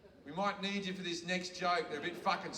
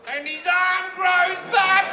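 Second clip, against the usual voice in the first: triumphant punchline; 12 semitones higher, 14 dB louder, slower than usual. Real, not acted.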